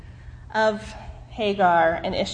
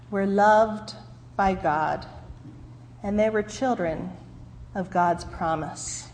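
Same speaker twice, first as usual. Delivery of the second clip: ordinary delivery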